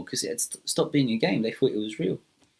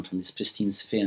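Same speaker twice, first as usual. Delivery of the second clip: plain reading voice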